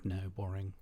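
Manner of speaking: ordinary talk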